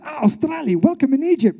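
high, delighted guard in broken Egyptian English; exuberant imitated welcome. Egyptian guard greets an Australian prisoner, imitated exuberant welcome. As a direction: burst into a hearty, high-pitched welcome. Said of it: "he says in broken English".

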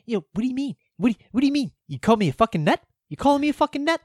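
a twitchy, defensive Richie impression; imitating Richie. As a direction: act offended and high-pitched. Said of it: "Richie's all like".

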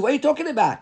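high-pitched, mildly incredulous challenge; incredulous objection. Acted. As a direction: voice Shmuel's objection, pitch jumping up. Acts out Shmuel's astonished objection.

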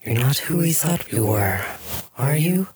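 an uncanny double-voiced question; haunting voice. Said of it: "kind of haunting, uh, dual voice, which I can't very well duplicate, but I'm gonna try".

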